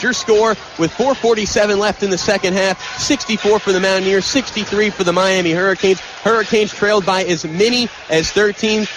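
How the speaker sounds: usual speaking voice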